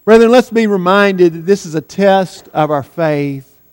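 ordinary talk

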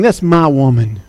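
a low, soft, menacing devil; Satan's menacing voice. Acted. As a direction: voice the devil claiming what's his, low and quiet. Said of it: "He's roaring to you, saying".

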